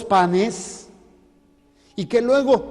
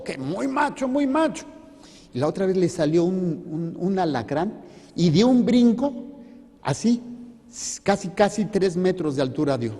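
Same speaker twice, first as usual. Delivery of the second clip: usual speaking voice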